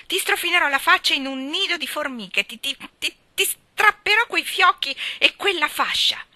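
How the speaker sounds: high, loud, sputtering threats; exasperated yelling. A narrator's reading. Gerald hunts for a threat to Ivy, exasperated yelling. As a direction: stammer and build to a triumphant yell. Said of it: "urlò Gerald esasperato".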